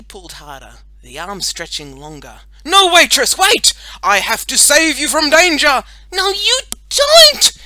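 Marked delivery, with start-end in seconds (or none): 2.6-5.8 very loud, high heroic pleading; urgent hero shout. A narrator's reading. Boy superhero tries saving unwilling girl, urgent shout. 6.1-7.5 high, loud, drawn-out refusal; angry shout. A narrator's reading. Girl yanks bottle from boy's grip, furious.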